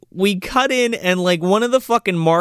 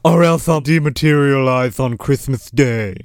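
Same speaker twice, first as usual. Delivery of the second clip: ghostly butler; 3 semitones lower than usual. Pretend. Joins the ghost butler riff.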